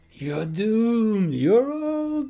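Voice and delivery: high, slow doomsayer voice; slow ominous raspy drawl. Retold or imitated. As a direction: draw out the warning, creepy and slow. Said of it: "That was embarrassing. I'm sorry".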